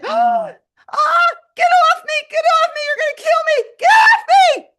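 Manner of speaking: very high, loud panicked screams; terrified shrieking. Retold or imitated. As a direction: scream to get it off you. Mouse jumps on her shoulder.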